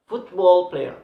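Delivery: football player, loud, player falling away; stress on foot. Shown as a demonstration. Teacher demonstrating first-word stress, loud stress on foot. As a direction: punch football, let player drop. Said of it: "So foot have a stress".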